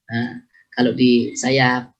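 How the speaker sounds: normal talking voice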